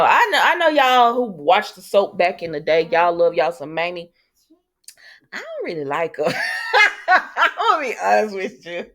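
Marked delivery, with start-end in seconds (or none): 5.3-9.0 laughing confession, breaking up between phrases. Real, not acted. Vlogger admits disliking Mamie to viewers, laughing. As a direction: admit it through bursts of laughter.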